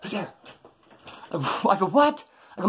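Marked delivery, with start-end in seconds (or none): 0.0-0.3 hushed. Retold or imitated. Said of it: "he goes". 1.9-2.2 startled what. Retold or imitated. Reacting to the robber's question.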